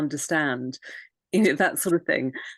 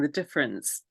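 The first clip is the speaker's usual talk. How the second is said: usual speaking voice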